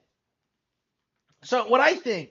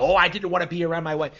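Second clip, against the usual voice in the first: quick, louder mock whine of an excuse; mocking quote; 2 semitones higher, 7 dB louder, faster than usual. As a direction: parrot his excuse dismissively, lightly put on. Mockingly quotes the man just played.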